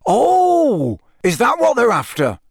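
loud, high, swooping "oh"; surprised realisation. A narrator's reading. Official learning what the foreign visitors want, surprised. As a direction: let the penny drop with a big "oh". Bagman finally understands the request.

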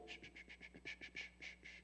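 hushing the angels in a whisper; whispered shushing. Retold or imitated. God to the singing angels, whispered shushing. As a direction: shh them quietly, a whisper. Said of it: "God goes".

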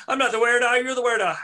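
high, loud indignant denial; hammed-up yelling denial; mock denial. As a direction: deny it hard and point back. Voiced brothers' accusation quarrel.

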